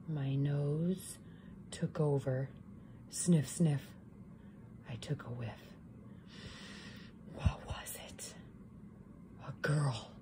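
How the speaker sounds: low, soft, half-whispered; hushed suspense. A narrator's reading. Wolf sniffing the air, hushed and suspenseful. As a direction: sniff it out in secret.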